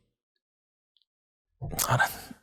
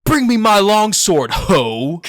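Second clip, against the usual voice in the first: booming, high, shouted line with a mocking dismissive inflection; bellowed dramatic line; 10 semitones higher, 10 dB louder, slower than usual. Student hamming up a Shakespeare line in class, loud mock bellow. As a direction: bellow it like a ham actor calling for a sword. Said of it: "it's like inflection".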